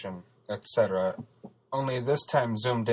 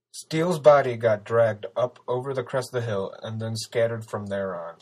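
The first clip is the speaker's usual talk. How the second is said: usual speaking voice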